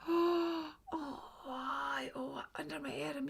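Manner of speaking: put-on a daft boy caught out, voice small; sheepish Jack.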